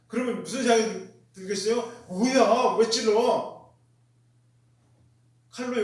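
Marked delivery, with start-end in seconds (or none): 2.1-3.7 louder, startled protest; acted-out cry of objection; indignant protest. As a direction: object sharply, as if just jabbed. Acts out a stabbed person protesting.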